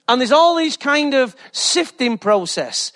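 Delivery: ordinary delivery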